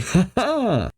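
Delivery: high acted "ha ha"; laughing. A narrator's reading. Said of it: "Night laughs, saying".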